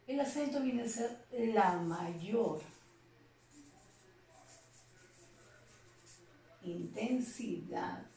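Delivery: dictating slowly, word by word, with long pauses; slow dictation. Shown as a demonstration. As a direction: spell out the definition slowly so students can copy it. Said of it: "vamos a definir el acento como". (dictado lento, palabra por palabra)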